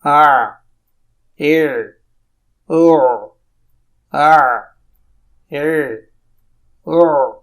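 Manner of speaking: aa, e, o pushed hard from the mid throat; strained throaty drill sounds; drill of ain vowels. Shown as a demonstration. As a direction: press the throat on each vowel. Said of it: "زور ڈالنا پڑتا ہے".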